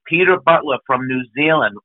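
normal talking voice

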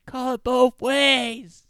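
loud, high, long-drawn heckle; yelling at refs. Retold or imitated. Auntie berating the ref, imitated drawn-out yell. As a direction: holler it slowly up high like an auntie. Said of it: "just yelling at the refs".

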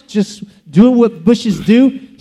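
ordinary delivery

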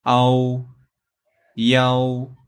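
"Ao, Yao" as held, level Mandarin syllables; Chinese pronunciation. Shown as a demonstration. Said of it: "In Chinese".

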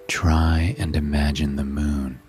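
ordinary delivery

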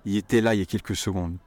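il était là il y a quelques secondes as a quick 'yétéla yé kékou s'gond'; fast colloquial French; as one run of sounds. Shown as a demonstration. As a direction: sound it out as a single stream. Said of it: "Think of it as sounds".

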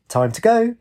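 high, sing-out chime; chimed. A narrator's reading. Wraith grabbing her arm, high chime. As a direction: lift the pitch well up, lightly. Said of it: "grabbed her arm and chimed".

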